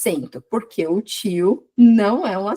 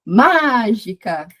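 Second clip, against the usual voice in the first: MÁ in "mágica" drawn out loud and slow, as if calling at the gate; calling out, stressed syllable stretched; 4 dB louder, much slower than usual. Shown as a demonstration. Teacher shows students the gate-calling trick, MÁ of "mágica" stretched. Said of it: "se você chamou no portão".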